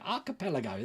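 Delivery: "Archipelago" read off softly as ar-ka-pel-ago; phonetic reading. Shown as a demonstration. Showing he can read it now, "Archipelago" softly sounded out. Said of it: "If you do it like that".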